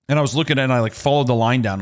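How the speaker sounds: ordinary delivery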